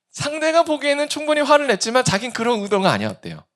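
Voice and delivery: ordinary talk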